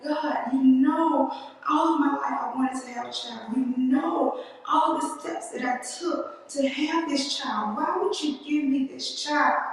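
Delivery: pleading with God in grief and anger, high and strained; crying out to God. Retold or imitated. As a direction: raise a raw, upset cry to God. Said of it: "I was crying".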